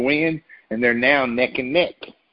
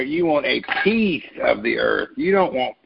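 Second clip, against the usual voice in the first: ordinary talk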